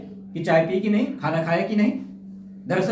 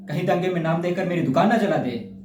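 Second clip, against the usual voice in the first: ordinary talk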